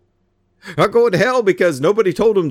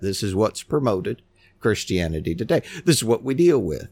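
ordinary talk, nothing marked

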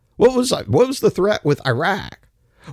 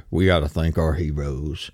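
mock patriot; 12 semitones lower, 3 dB quieter than usual. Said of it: "and they're like".

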